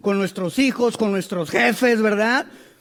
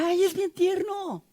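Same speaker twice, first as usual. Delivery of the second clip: mock tender; 7 semitones higher, 6 dB quieter, slower than usual. Retelling how people react.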